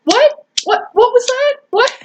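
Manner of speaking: high-pitched repeated what; mock outraged squeaky questioning; indignant disbelief. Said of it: "I'd be like".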